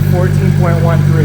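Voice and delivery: loud repeat of fourteen point one three. Real, not acted. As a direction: call the figure again over engine noise. Repeats the reading over engine.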